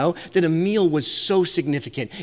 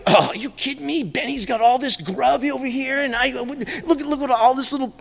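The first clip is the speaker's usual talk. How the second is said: higher, whiny complaint of a jealous brother; mock the envious sibling griping over the food; a brother who thinks he got shortchanged, whining about it; grumbling brother; 8 semitones higher than usual. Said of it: "No, like".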